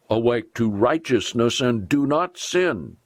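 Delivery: ordinary delivery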